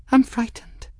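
very high, small scared voice; childlike fright, pitched far up; frightened. Acted. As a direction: confess fear in a high voice. Frightened Folly confides in her master.

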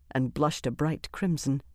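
ordinary delivery, nothing marked

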